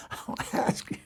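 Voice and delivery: breathy voiceless whisper, holding back a laugh. Retold or imitated. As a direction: whisper it conspiratorially.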